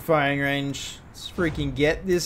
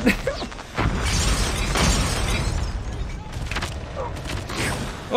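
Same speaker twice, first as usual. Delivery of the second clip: wheezing high laugh; high breathless laughter. Real, not acted. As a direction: cackle in disbelief. Laughing after grenades.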